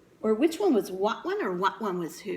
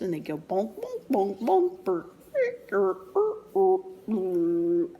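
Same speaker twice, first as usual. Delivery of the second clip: machine noises. Retold or imitated. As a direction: mimic a clunking machine. Machine noises while reading.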